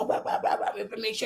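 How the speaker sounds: soft dismissive blah blah; mocking blah blah. Mocking people who only repeat information, dismissive. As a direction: toss the words off.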